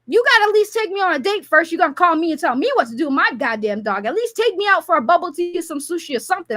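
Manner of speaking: ordinary talk, nothing marked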